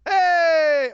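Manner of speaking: high, drawn-out "hey"; loud exclamation. Real, not acted. Host reacting to co-host's answer, loud high exclamation. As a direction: burst out with a long, high shout.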